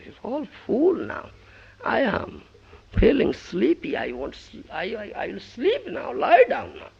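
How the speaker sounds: high, loud contented voice; satisfied Krishna. Acted. Krishna telling Draupadi he is full. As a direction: sound stuffed and content.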